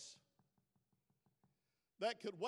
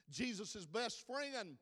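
normal talking voice

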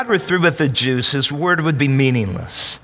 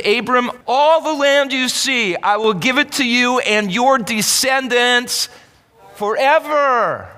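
higher, the promise ringing out; voicing God; 6 semitones higher than usual. Preacher voicing God to Abram, ringing promise.

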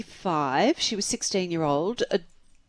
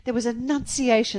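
ordinary delivery

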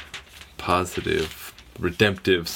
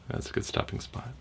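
ordinary delivery

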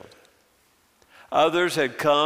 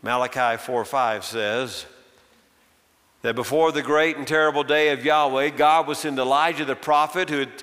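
ordinary talk, nothing marked

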